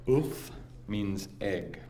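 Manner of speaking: Ouf said slowly, clipped French vowel; French words. Shown as a demonstration. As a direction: teach Ouf slowly to the crowd. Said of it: "here's an example".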